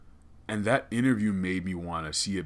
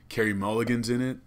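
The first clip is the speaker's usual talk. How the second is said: usual speaking voice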